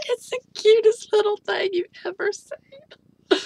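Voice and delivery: very high laughing squeal; laugh-cry at the cuteness. Real, not acted. Gushing over the doll, squealing through laughter.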